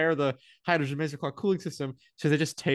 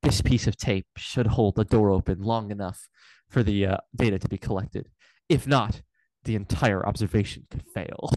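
low, ominous documentary narrator; deep, portentous voiceover with weighty pauses; dramatic announcer; 5 semitones lower than usual. Retold or imitated. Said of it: "in this incredibly dramatic voice".

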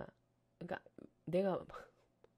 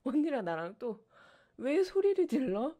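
higher, louder mock child protest; mock childish talk-back, raised voice; kid asking why; 7 semitones higher, 5 dB louder than usual. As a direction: imitate a defiant kid whining why. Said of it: "그래서".

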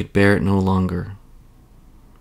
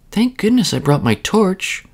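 put-on high relieved little girl; a child glad she came prepared; 11 semitones higher than usual. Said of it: "she said to herself".

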